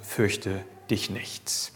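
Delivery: very soft, slow and low soothing; tender comfort. Acted. Father holding the scared child, soft and slow. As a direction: hush a frightened child in your arms.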